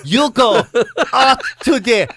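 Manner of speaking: slow, halting mock foreign accent, laughing; Borat. Mate gives a Spanish lad directions, hammed-up foreign accent. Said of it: "turn into fucking Borat".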